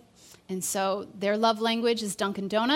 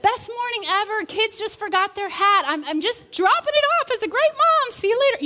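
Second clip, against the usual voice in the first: much higher, chirpy put-on brightness; fake cheerful; 11 semitones higher, 5 dB louder than usual. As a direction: pretend everything is perfect. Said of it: "make up this fake story".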